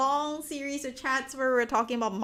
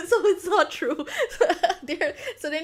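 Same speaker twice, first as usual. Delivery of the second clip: higher, louder, through laughter; laughing; 6 semitones higher, 5 dB louder than usual. Real, not acted. Streamer correcting a viewer in chat, laughing. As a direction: say it while cracking up.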